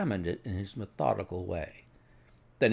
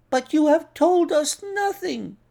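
very high, louder protest; protesting cry; 5 dB louder than usual. A narrator's reading. Doctor protests that Holmes explains nothing, exasperated cry. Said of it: "cried the doctor".

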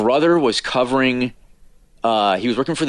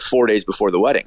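normal talking voice

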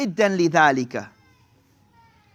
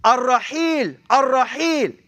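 calling out from the city wall; 4 semitones higher, slower than usual. Retold or imitated. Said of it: "and he would yell out".

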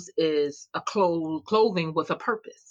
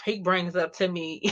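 usual speaking voice

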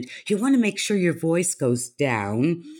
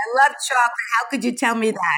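mock dismay; 6 semitones higher, 4 dB louder, faster than usual. Mock protest at banning chocolate.

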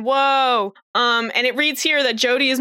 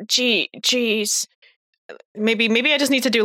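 ordinary delivery

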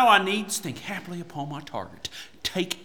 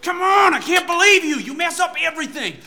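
angry scolding; 8 semitones higher than usual. Acted. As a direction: yell at her in frustration. Knight scolding servant.

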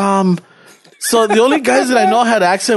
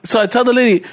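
ordinary talk

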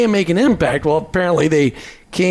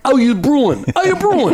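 put-on New Jersey accent; 8 semitones higher than usual. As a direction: ham up a Jersey "how ya bruin". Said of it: "pull out your Jersey accent there".

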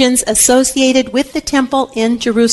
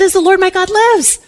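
higher-pitched earnest vow, lightly acted; vowing Anna; 7 semitones higher than usual. Anna promises her child to God, lightly acted vow. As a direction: pledge it solemnly in a raised pitch. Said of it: "And Anna said".

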